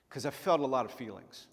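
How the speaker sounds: ordinary talk, nothing marked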